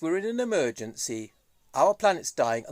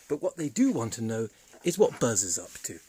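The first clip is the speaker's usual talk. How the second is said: normal talking voice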